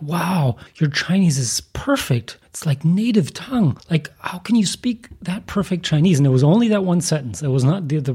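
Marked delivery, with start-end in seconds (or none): 0.0-6.2 mock astonishment, pitched higher; amazed praise. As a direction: imitate an impressed native speaker, voice lifting. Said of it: "the eyes of the Chinese lit up".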